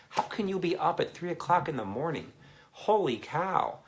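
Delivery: high-pitched, incredulous exclamation; amazed. Real, not acted. Streamer to viewer up at three in the morning, amazed.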